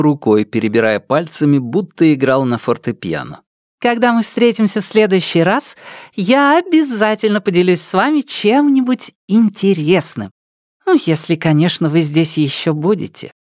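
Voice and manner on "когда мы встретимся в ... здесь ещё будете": high, playful promising voice; cheerful with a sly sting; playful lady. Acted. Nelidova promising. (игривый девичий голос)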